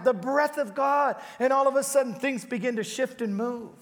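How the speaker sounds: preacher's climactic appeal about the breath of God; impassioned preaching. Acted. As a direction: preach it with rising fire. Preaching at full force.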